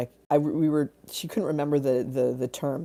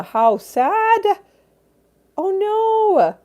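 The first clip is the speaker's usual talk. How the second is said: high, loud wail of sympathy; drawn-up, distressed cry for a friend; pained sympathy; 6 dB louder, much slower than usual. Real, not acted. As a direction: wail it in real dismay. Reacting to viewer's bad news.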